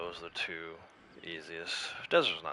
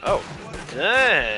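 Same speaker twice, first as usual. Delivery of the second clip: surprised exclamation; 8 semitones higher, 10 dB louder than usual. Real, not acted. Sudden shot surprise.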